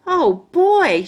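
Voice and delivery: high, loud happy gasp of delight; thrilled little girl squeal; excited. A narrator's reading. Grace delighted by the birthday invite, high and loud. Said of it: "she said".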